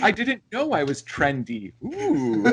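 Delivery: high, playful pretend delight; hammed-up pleased surprise; mock flattered surprise. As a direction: act tickled, rising into a high "ooh". Joking acted reaction to being trendy.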